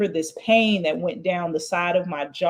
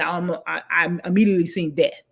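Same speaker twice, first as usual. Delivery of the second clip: normal talking voice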